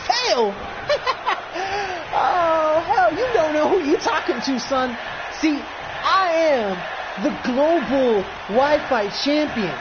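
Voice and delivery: cocky champion promo. Acted.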